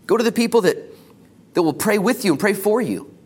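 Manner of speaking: loud, high, rising sermon call; preaching. Acted. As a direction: build the exhortation with fire. Rising exhortation to the congregation.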